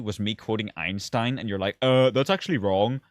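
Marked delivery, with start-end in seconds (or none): 1.8-3.0 louder, nasal-free pedantic mimic; a hint of a snotty commenter; mock know-it-all. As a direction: play the smug corrector, lightly. Mocking commenter's correction.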